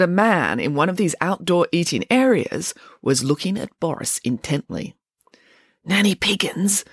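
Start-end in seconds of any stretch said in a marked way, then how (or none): none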